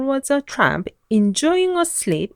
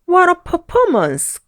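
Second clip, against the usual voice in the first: dry remark; 9 semitones higher, 4 dB louder than usual. A narrator's reading. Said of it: "commented the worm".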